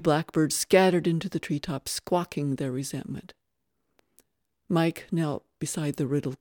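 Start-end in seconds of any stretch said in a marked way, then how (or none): none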